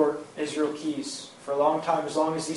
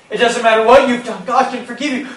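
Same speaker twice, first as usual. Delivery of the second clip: higher and louder, begging him to listen; urgent plea; 7 semitones higher, 10 dB louder than usual. Retold or imitated. Wife begging her husband on the porch, pleading loudly. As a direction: raise it into a desperate plea.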